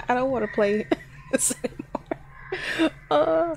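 laughing through the words nervously; nervous laughter. Real, not acted. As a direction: laugh it out, half scared. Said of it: "I'm laughing".

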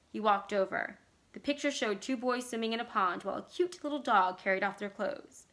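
ordinary delivery

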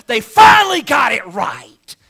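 shouted high and slow; triumphant preacher's shout; preaching climax. Real, not acted. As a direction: ring it out to the back row.

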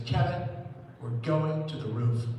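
slow, low, emphatic command; mock-ominous, deliberate delivery; ominous order. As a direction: lay down a stern order slowly. Date's ominous order, retold.

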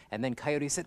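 ordinary delivery, nothing marked

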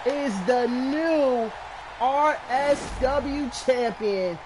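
usual speaking voice

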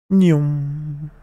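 a loud, hammed-up "nyum" munch; mock munching noise. Gamer to his viewers, a loud silly munch. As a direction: smack out a silly eating sound. Gameplay vlog catchphrase noise.

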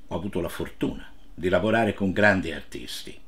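usual speaking voice

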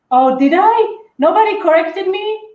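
high, loud disbelieving question; surprised realisation. Real, not acted. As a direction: pitch shoots up as you catch your own slip. Suddenly notices own mistake on stream.

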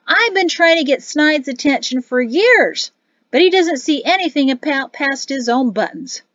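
brash soldier ranting to his mates; loud boisterous sergeant. Acted. Sergeant complaining.

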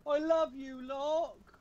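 high-pitched gushing fan voice. Acted. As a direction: squeal it out like an adoring fan. Reading fan message in put-on voice.

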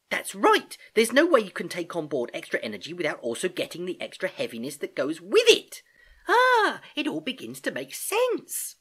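put-on high, loud young niece, delighted; excited realisation. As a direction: let the penny drop with a big "ah". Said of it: "Gedankin brightened up".

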